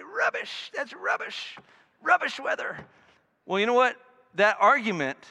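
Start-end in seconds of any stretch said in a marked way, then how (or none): 0.0-2.8 imitating a little boy's South London accent, much higher; squeaky child's South London "rubbish". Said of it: "tough South London accent".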